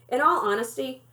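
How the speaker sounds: ordinary delivery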